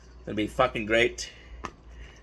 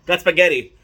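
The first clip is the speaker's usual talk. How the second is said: loud, proud reveal of spaghetti; excited announcement; 3 semitones higher, 7 dB louder than usual. Real, not acted. Announcing the dish with gusto.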